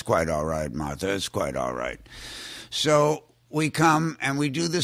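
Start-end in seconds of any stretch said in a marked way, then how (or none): 0.0-2.0 Hitchcock voice. Retold or imitated. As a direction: lower the voice into a haughty Hitchcock. Hitchcock voiced in retold story.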